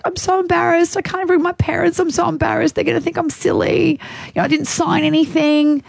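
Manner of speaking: high-pitched imitation of a tearful young woman; retold tearful upset. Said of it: "And then she started crying".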